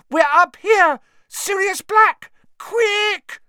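desperate high scream for help. Acted. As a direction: shriek the warning downstairs. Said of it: "Hermione screamed suddenly".

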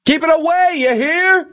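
very high, frantic warning; high-pitched screamed threat; Milosevic screaming. A narrator's reading. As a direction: screech the order in panic. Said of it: "Milosevic screamed".

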